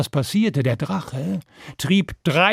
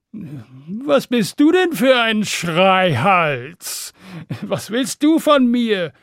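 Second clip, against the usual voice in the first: big, drawn-out, raised dragon voice; indignant dragon; 5 semitones higher, 3 dB louder, slower than usual. Acted. Dragon demanding of Stan what he wants, indignant. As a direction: challenge the little shouter, high and slow.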